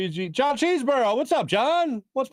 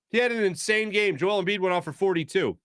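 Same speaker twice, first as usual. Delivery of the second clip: normal talking voice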